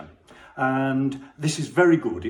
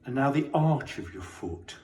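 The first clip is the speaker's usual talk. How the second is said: guided body scan; 6 dB quieter than usual. Acted. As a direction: guide them gently through the body, leaving space.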